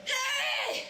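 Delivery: high loud furious shout; angry. Shown as a demonstration. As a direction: yell it out in anger. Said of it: "like you're angry".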